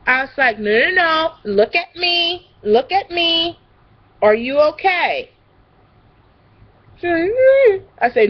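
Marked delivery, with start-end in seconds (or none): 0.6-5.3 put-on slow, raised child-directed voice; retold firm soothing. Nanny to a screaming little girl, firm soothing. 7.3-7.8 high, loud little "mm"; imitated child whine. Little girl answering the nanny, imitated whine.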